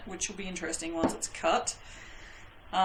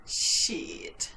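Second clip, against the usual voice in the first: whispered curse. Real, not acted. Muttered curse at mistake.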